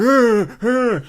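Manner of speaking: high loud walrus bellow. Retold or imitated. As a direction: roar it out like a fighting walrus.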